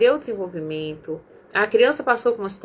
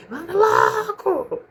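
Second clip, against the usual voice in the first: high, slow, drawn-out mock yell; mock yelling mom; 10 semitones higher, much slower than usual. Mother losing patience with her boys, hammed-up yell. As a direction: play the impatient mom, stretching it high. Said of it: "o meu limite era gritar".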